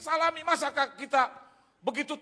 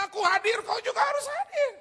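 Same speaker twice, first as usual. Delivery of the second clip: loud, high proclamation; God's booming command; 9 dB louder than usual. Retold or imitated. God telling the reluctant preacher to be present, loud high command. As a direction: proclaim it at full volume, pitched high.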